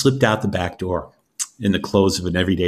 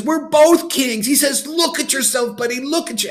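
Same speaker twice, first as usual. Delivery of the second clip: gleeful declaration. Acted. Beggar tells arguing king they are both kings, high and gleeful. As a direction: savor the revelation with glee.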